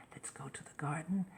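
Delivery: put-on whispered secret to a child; conspiratorial whisper to the boy. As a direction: breathe it so nobody else hears.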